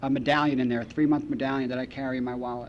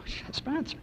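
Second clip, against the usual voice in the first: whisper, pitched higher; hushed reveal; 11 semitones higher, 9 dB quieter than usual. Retold or imitated. AA members whispering to the son about his mother. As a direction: breathe it like a secret. People reveal mom's secret.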